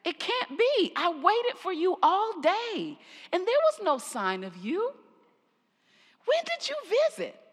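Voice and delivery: high, astonished disbelief; surprised. A narrator's reading. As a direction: pitch climbing in shock toward the question.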